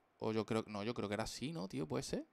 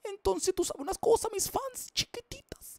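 much higher, louder cartoon squeak; squeaky silly voice; 6 dB louder, slower than usual. Streamer teases his fans in a high squeaky voice. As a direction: squeak it like a tiny cartoon creature.

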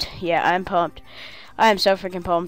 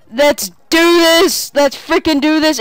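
pumped shout; 7 semitones higher, 5 dB louder than usual. Real, not acted. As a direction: roar it to hype yourself.

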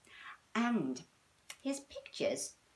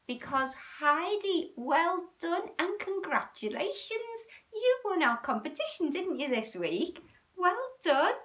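excited congratulations; 10 semitones higher than usual. Real, not acted. Praising little Heidi for winning the competition, excited. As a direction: cheer her on, delighted.

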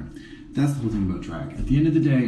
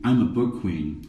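ordinary delivery

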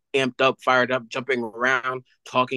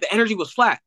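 blunt coach; 8 semitones higher, faster than usual. Retold or imitated. Coach explains bad workout.

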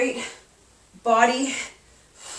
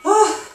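effort grunt; 13 semitones higher, 8 dB louder than usual. Real, not acted. Straining through a workout move.

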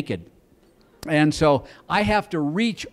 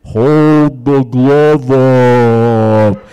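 very slow, loud, low slow-motion yell; distant shout; 5 semitones lower, 11 dB louder, much slower than usual. Retold or imitated. Wife yelling from far off in slow motion, drawn-out shout. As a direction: stretch every word like slow motion. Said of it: "I can hear way off in the distance".

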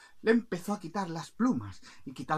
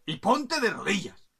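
loud, raised royal outburst; indignant king; 5 semitones higher, 7 dB louder than usual. Acted. King scolds a passing bird, loud and indignant. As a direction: challenge the bird loudly, affronted. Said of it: "Y el rey, viéndolo, dijo".

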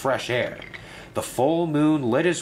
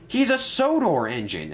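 exclaiming; 7 semitones higher than usual. A narrator's reading. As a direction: blurt it out in alarm. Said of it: "exclaimed Ryan".

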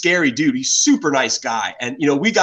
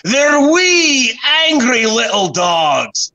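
loud, high, drawn-out mock-Scottish cry; Scottish accent; 8 semitones higher, 4 dB louder, much slower than usual. Quoting a Scottish friend on Highland terriers, in an exaggerated Scottish accent. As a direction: belt it out slowly in a put-on Scottish accent. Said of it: "forgive my Scottish accent".

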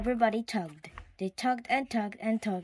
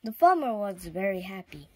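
plain reading voice

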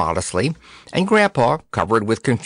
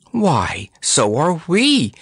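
slow, high-rising surprise; surprised cry; 8 semitones higher, much slower than usual. A narrator's reading. Dorothy learns they seek a princess too, surprised. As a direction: exclaim it in drawn-out astonishment. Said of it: "cried Dorothy in surprise".

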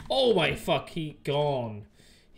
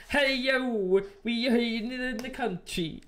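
high, sing-out mock country Swedish; country Swedish accent; 9 semitones higher, slower than usual. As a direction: put on a hammed-up rural Swedish accent. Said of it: "a country Swedish person".

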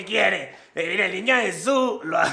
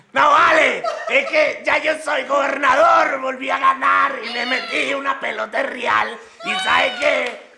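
shouted, gloating announcement; boastful excitement; 3 semitones higher than usual. Acted. Gobernador presume eufórico ante Santa. (eufórico y fanfarrón, a gritos)

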